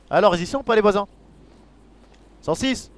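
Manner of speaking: loud call across the street. Real, not acted. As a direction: shout it out so they hear. Calling out for the neighbours.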